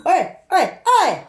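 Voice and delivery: high, loud repeated bursts; mimicked shout. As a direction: bark it three times to get attention. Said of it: "That's when he shouted at you".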